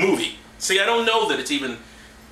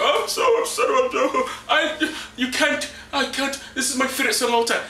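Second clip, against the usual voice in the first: hammed-up sobbing father, much higher; mock weeping Jacob; 8 semitones higher than usual. Father wails over his favourite son, hammed-up and much higher. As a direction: overdo the grief, half-laughing. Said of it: "I'm just gonna cry for you".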